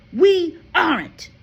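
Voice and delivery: loud, slow, final; blunt father. A narrator's reading. Father tells Matilda they are never coming back. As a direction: drop it like a verdict.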